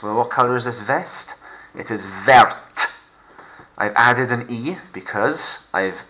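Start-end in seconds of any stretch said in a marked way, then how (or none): 1.7-2.9 "verte" with the final t sounded; slow, hit the t of verte; French verte. Shown as a demonstration. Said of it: "Look what the addition of the E does".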